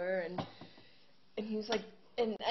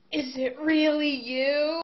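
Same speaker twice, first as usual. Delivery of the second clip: slow, higher, much louder gasp of awe; starstruck fan; 7 semitones higher, 13 dB louder, slower than usual. Retold or imitated. Fan meets singer on the sidewalk, awestruck and loud. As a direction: play the awestruck stranger.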